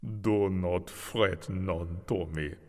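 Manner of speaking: put-on slow, lilting Vollis accent; gentle sing-song Vollis voice from memory. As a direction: soothe him in a sing-song Vollis lilt. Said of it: "a sing-song Vollis accent sounded in his head".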